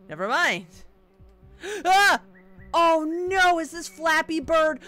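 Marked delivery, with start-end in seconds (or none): 1.6-3.6 startled shout. Real, not acted. Surprise at new hazard.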